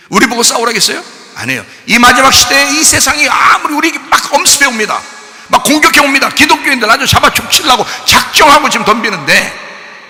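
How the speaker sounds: shouted sermon, pitched high; full-force preaching. Real, not acted. Preacher warning believers of attack in the last days, shouting. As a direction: raise the alarm to the congregation at the top of the voice.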